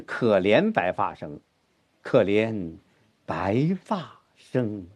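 白发 weighted, slow, pause after 可怜; low and slow, lean on 白发; stress on 白发. Shown as a demonstration. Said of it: "重音是白发". (重音在“白发”，放慢)

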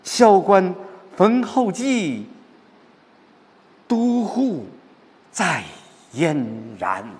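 impassioned, soaring recitation of the final lines; rising patriotic fervour. Shown as a demonstration. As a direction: build the pride to a ringing peak. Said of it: "尤其看到后边".

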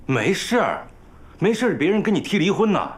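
put-on scolding older brother; indignant retort. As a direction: throw the dismissal back at him. Brother indignantly echoes the dismissal.

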